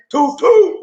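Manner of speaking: high, loud playful toot; train whistle toot. Acted. Host reading a love train greeting, high whistle toot.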